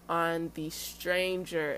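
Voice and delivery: "stranger" drawn out slowly for emphasis; stress on stranger. Real, not acted. Warning viewers about new dates, slow emphasis on "stranger". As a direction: lean on "stranger", stretching the word. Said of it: "Emphasis on stranger".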